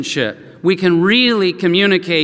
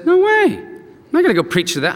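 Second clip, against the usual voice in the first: indignant refusal; 9 semitones higher than usual. Acted. Jonah refusing God's command to go preach, indignant. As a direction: balk like a stubborn prophet.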